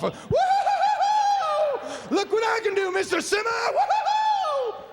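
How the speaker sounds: high whooping mock joyride; gleeful high-pitched hollering with drawn-out woo-hoos; goofing off. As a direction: whoop and show off to the trucker. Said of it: "like I'm goofing off".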